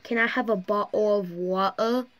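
light mock British accent, "bottle of water" with dropped t's; a cheeky British accent imitation; playful British "bottle of water". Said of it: "British people be like".

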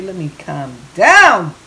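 slow, ending in a loud burst; excited. Real, not acted. Cook worked up over the food, excited. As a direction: draw it out, then punch the last word. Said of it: "I'm getting so excited over here".

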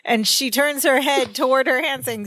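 normal talking voice

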